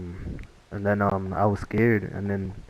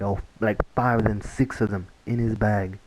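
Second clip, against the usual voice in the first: ordinary talk, nothing marked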